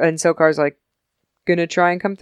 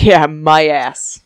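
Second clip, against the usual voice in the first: slow, louder scoffing; sarcastic scoff; 6 dB louder, much slower than usual. Retold or imitated. Apophis scoffing at Daniel's threat, slow. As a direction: drag it out dismissively. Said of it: "And Apophis is like".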